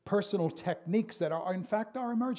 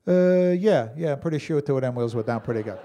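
drawling pilot; 9 dB louder than usual. Retold or imitated. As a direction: shrug it off like a careless pilot. Pilot unsure about wheels.

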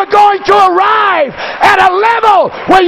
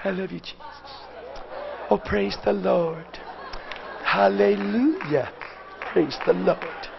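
much softer, hushed mock-pious murmur; plastic piety; 10 semitones lower, 16 dB quieter than usual. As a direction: imitate a fake churchy mumble. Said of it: "that little plastic".

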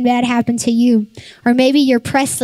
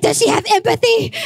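high, mock-alarmed question; pitched way up in comic concern; retold parental dismay; 10 semitones higher than usual. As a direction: play up a worried mom's disbelief. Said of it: "I was like".

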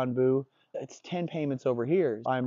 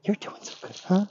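princess voice; 7 semitones higher than usual. Shown as a demonstration. Trainer rewarding a dog, princess voice. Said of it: "these extra princess voices that I'm doing".

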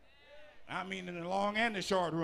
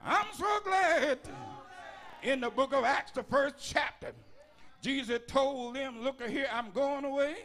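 higher, louder shouted litany of pressures; preaching at full force; 5 semitones higher, 4 dB louder than usual. Real, not acted. Preacher shouting the list of pressures to the congregation, in a loud, high shouted preaching voice. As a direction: hammer each pressure out like a hellfire preacher.

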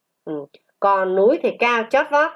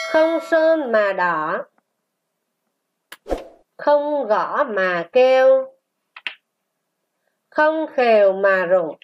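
slow, sustained syllables; model reading. Shown as a demonstration. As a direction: read it slowly for a class to repeat. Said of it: "Chúng ta đọc lại". (đọc mẫu chậm, ngân dài)

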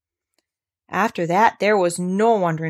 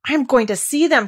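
higher, louder determined declaration; eager cry; 6 semitones higher, 5 dB louder than usual. Acted. Colin to Dickon about the flowers, eager cry. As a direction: insist with bursting eagerness. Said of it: "cried Colin".

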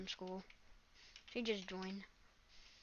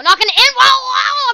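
panicked shout; 12 semitones higher, 14 dB louder than usual. Real, not acted. Car spinning out.

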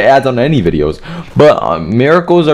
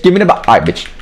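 ordinary delivery

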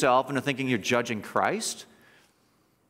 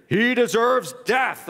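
high, slow mock proclamation; drawn-out, raised-pitch condemnation; condemning verdict; 6 semitones higher, slower than usual. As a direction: pronounce the sentence as an acted angry mob. Acts the council shouting its verdict.

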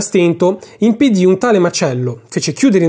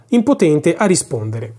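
ordinary delivery

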